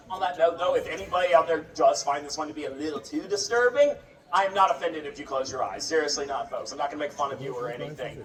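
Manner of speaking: ordinary delivery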